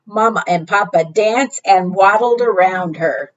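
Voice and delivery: ordinary delivery, nothing marked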